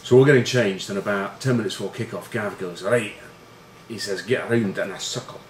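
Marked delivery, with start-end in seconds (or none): 2.8-3.1 Scottish captain calling the team in; crap Scottish accent. Retold or imitated. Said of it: "Crap accent". 4.2-5.4 badly imitated Scottish captain; rally the team in a poor Scottish accent; crap Scottish accent. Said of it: "Crap accent".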